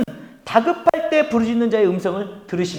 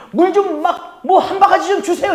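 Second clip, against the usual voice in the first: casual demand; 8 semitones higher, 5 dB louder than usual. Retold or imitated. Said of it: "이것도 아니에요".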